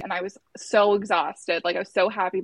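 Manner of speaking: ordinary delivery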